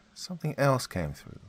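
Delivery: ordinary delivery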